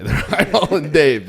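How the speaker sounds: laughing. Real, not acted. Cracking up at own cannibalism story.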